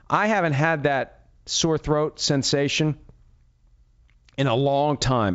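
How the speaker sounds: ordinary talk, nothing marked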